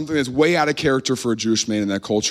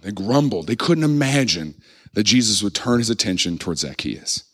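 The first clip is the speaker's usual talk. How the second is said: usual speaking voice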